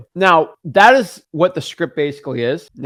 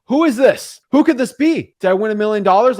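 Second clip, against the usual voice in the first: higher, louder, acted wondering; eager anticipation; 5 semitones higher, 5 dB louder than usual. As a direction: play a seller burning to know who is calling. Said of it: "there's a lot of anticipation".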